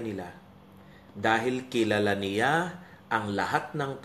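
slow, low-pitched Bible reading; measured reading to a congregation; scripture reading. Acted. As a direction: read the verse deliberately, letting each phrase land. Reads Bible passage aloud.

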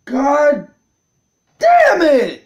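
loud, high angry shout; real outburst of frustration; furious curse. Real, not acted. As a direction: break it in two and yell the end. Player furious at own mistake.

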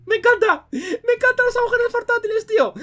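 high, squealing enthusiasm; delighted excitement. Real, not acted. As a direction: gush in a high-pitched voice. Entusiasmo por el agujero portátil. (entusiasmo agudo, encantado)

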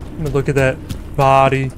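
normal talking voice

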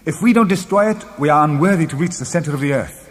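high, impassioned argument; exclaiming. A narrator's reading. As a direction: insist with mounting fervour. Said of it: "I exclaimed".

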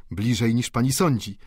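ordinary delivery

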